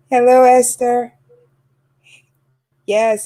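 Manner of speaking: normal talking voice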